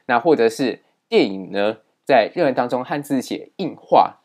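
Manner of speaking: ordinary delivery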